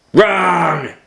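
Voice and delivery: loud gruff correction; gorilla's flat no. Acted. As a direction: bark it. Gorilla contradicts.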